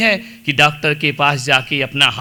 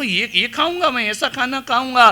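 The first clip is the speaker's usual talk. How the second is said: higher-pitched, offended protest; indignant father-in-law; 7 semitones higher than usual. Acted. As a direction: act out mild outrage at being served this. ससुर का बहू पर गुस्सा. (नाराज़ ससुर का ऊँचे सुर में विरोध)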